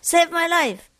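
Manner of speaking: high-pitched plea, no real volume; lightly acted calling for rescue; mock cry for help. Man hanging from a cliff, mock calling for help. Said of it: "he was shouting for help".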